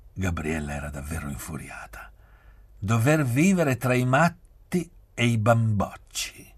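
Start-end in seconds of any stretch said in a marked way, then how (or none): 2.8-6.5 higher, louder, drawn-out scorn; mean, disgusted complaint; nasty disgust. A narrator's reading. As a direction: spit it out slowly with loud, raised disgust. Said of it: "disse cattiva, con disgusto".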